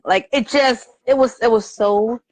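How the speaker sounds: ordinary delivery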